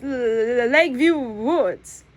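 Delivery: stumbling over the first sound; nervous stammer on Lakeview. Acted. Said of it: "I stumble over my words".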